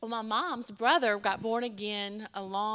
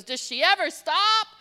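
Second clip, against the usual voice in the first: amazed uncle; 8 semitones higher, 10 dB louder than usual. Retold or imitated. Uncle's amazed question, acted.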